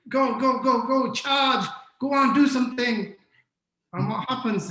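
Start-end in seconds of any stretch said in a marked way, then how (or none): 0.1-3.1 loud, high barked commands; screamed orders. Acted. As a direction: yell at your team like a drill boss. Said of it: "you're gonna scream at them".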